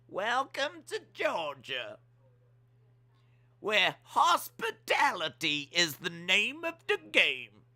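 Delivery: slow, high Southern Georgian drawl; Georgian accent. Acted. Georgian accent welcoming visitors to Georgia. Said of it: "that was my Georgian".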